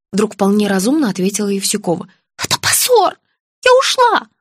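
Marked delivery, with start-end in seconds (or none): 2.4-4.3 high, agitated exclamations; agitated old woman. A narrator's reading. Patient recalling leaving home in disgrace, agitated. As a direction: cry out the shame in a high voice.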